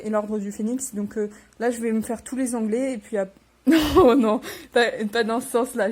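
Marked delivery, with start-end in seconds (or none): 3.5-5.9 laughing. Real, not acted. Correcting her own slip to viewers, laughing. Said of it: "laughs at own slip".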